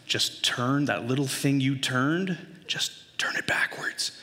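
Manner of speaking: slow instructions sinking into a whisper; gentle coaxing. Acted. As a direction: coax gently through the door, hushing toward the end. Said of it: "I tell her gently through the door".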